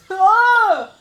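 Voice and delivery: loud, high yelp of disgust; disgusted shout. Real, not acted. Reacting to friend's gross joke, loud disgusted yelp. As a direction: recoil out loud.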